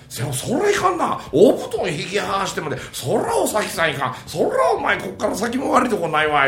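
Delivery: high-pitched theatrical rakugo reproach; disapproving neighbour. Acted. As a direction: exclaim in exaggerated agreement with a wronged man. Neighbour exclaims over the quarrel.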